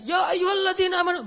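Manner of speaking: high, loud declaiming recitation; shouted recitation. Shown as a demonstration. Preacher showing the wrong way, loud and high. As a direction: belt the verse out like a fiery preacher. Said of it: "Makanya bacanya jangan".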